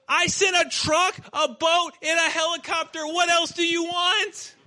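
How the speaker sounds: exasperated shout. Acted. God answers the drowned man with a loud, exasperated shout. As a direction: throw the punchline at him in comic disbelief.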